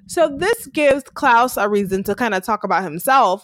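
ordinary talk

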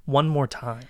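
a captive and her ex-lover, understated; acted drama scene. Captive woman confronting the ex who tied her up, tense and hushed.

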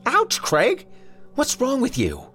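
pinched, high-pitched indignant wife; bewildered annoyance. A narrator's reading. Wife protests at husband grabbing her arm, bewildered annoyance. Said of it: "pinched into an expression of bewildered annoyance".

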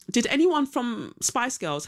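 normal talking voice

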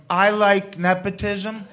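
slow, deliberate delivery; spelling bee sentence. Retold or imitated. Guest star using the word in a sentence to camera, slow and deliberate. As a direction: present it slowly, word by word. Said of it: "looking at the camera and going".

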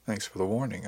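ordinary delivery, nothing marked

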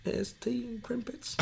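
high, slow, soft fake English; mock English accent. Shown as a demonstration. Proving to friend it's English, hammed-up English accent. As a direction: put on a silly dainty English accent.

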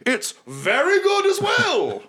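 high, slow, shouted plug; hammed-up sales pitch. [name] shouting a plug for his restaurant, high and hammy.

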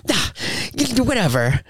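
high, huffy mock brush-off; miffed dismissal. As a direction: imitate a sore loser brushing it off. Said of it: "like that".

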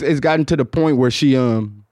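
usual speaking voice

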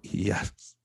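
weak, breath-only mutter after a long pause. Retold or imitated. Broken patient answering God's voice, faint mutter. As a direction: barely get the word out. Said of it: "gave me the strength to just mutter out".

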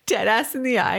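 ordinary delivery

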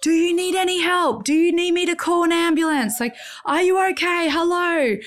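high retold call across a distance; mock yell of concern to a stranger. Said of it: "I started yelling out to them".

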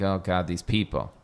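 low, soft disdainful aside; looking down on them. Retold or imitated. Host imitating a viewer of trashy reality TV, quiet disdain. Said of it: "same feeling looked down upon".

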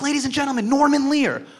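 high, building emcee announcement; presenting a legend to the crowd, emcee style; announcer intro. Retold or imitated. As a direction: announce him like a host. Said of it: "I was like".

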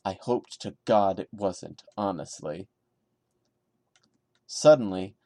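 usual speaking voice

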